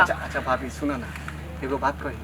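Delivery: low, soft wheedling; coaxing. Acted. Villager coaxes scolding sister-in-law to listen, hushed and meek. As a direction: drop the voice and gently ask for attention.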